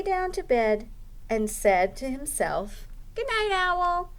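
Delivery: lively storytime narration; reading to children. Acted. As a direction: read it like a children's librarian at storytime. Librarian reads picture book aloud.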